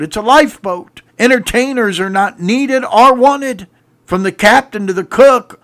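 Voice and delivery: loud, high, driving pulpit preaching; full-force preaching. Acted. Preacher rallying his congregation, fiery and loud. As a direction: preach it out to the back pews.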